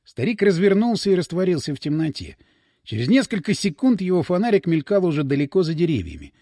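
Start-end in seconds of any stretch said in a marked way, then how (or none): none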